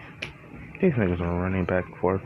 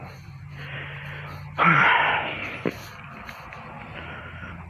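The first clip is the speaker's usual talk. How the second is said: pained crying sigh; 9 semitones higher, 4 dB quieter than usual. Real, not acted. Chest hurts from hiccups.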